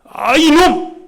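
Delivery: high, loud rebuking outburst; acted furious telling-off; angry scolding. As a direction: thunder it like an outraged accuser. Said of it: "그들이 호통을 칩니다".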